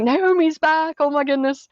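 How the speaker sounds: high, amazed townsfolk chatter; excited gossip. Retold or imitated. Townspeople seeing Naomi return, excited and high. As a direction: gasp it out like startled neighbours. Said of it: "They're like".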